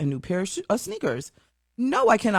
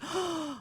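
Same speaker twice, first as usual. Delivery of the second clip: retold gasp; 11 semitones higher, 11 dB quieter than usual. Mom reacting to son's toe.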